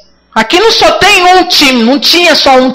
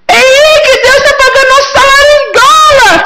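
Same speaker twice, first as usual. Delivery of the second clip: excited shout; 11 semitones higher than usual. Acted. Preacher mimicking a believer thrilled by dollars, shouting. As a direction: shout it like you just got paid in dollars.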